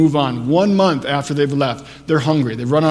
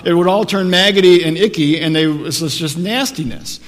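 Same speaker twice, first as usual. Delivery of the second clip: louder, driving sermon delivery; preaching at full force; 2 semitones higher, 6 dB louder than usual. Acted. Pastor pressing the manna story on his congregation, preaching hard. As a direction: preach it out, building energy.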